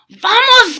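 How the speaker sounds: high, loud urgent call; cry. Acted. Chimichangos crying to Skipito to flee. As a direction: yell at him to run. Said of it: "they cried".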